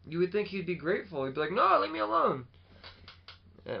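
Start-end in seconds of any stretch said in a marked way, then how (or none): 1.5-2.5 high, louder whiny objection; mock protest. Podcaster imitates ungrateful Banner refusing help, mock protest. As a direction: push someone away in a hammed-up high voice.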